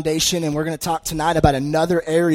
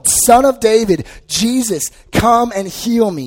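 higher, louder, slow desperate plea; crying out; 6 semitones higher, 5 dB louder, slower than usual. Retold or imitated. Blind man shouting to Jesus over the crowd, desperate pleading cry. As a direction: call out over the crowd, begging to be healed. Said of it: "he cries out all the more".

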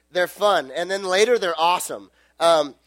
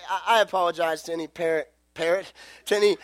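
ordinary delivery

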